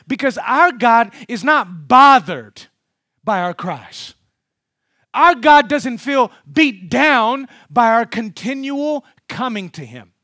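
loud, slow, high pulpit cry; full-force preaching. Acted. Preacher to congregation, loud and slow. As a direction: preach it out to the whole hall.

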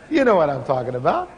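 ordinary talk, nothing marked

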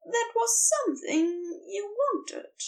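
lightly voiced Aziraphale, careful; testing each word, a hint of the angel. A narrator's reading. Angel offering to Crowley, carefully, lightly voiced. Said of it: "Aziraphale says carefully".

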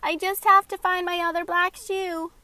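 put-on high, loud child call; yelling across the room. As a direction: keep calling down the stairs. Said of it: "Maxie called back".

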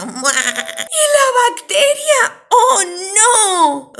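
slow, high, loud wail; drawn-out theatrical shriek; hammed-up horror. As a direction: cry out in mock dread. Alarm as second villain appears.

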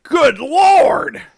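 high loud delighted "Good Lord"; amazed exclamation. Retold or imitated. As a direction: shout it out, thrilled by the taste. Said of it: "and I was like".

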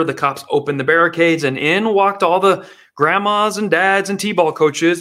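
ordinary delivery

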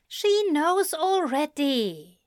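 high, earnest pleading; pleading angel. A narrator's reading. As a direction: plead with him. Aziraphale confesses.

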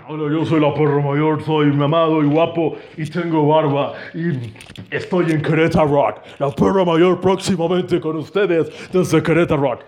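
low bragging buddy voice; lightly voiced cocky friend; a hint of a macho buddy showing off; voicing friend. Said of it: "los dejo con mi amigo".

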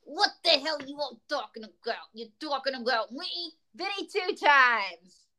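hammed-up New York wise-guy voice; New York accent. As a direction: play a bad magician in a New York accent. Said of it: "I'll give him a New York accent".

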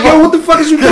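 high, loud, panicked demand; scared yell. Retold or imitated. Retelling how he challenged the sleepwalker, scared shout. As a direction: shout it like you've been badly spooked. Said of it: "I'm scared as shit".

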